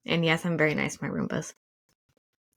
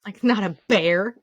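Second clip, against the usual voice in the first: exasperated correction; 5 dB louder than usual. Retold or imitated. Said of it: "the guy's like".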